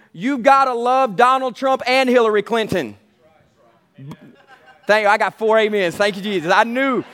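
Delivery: ordinary delivery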